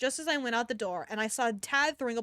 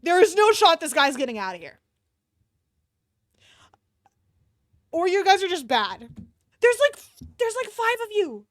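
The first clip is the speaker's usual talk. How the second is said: loud, higher exasperated rant; real frustration; 7 semitones higher, 7 dB louder than usual. Real, not acted. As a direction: yell at the characters in disbelief. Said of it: "This is pissing me off".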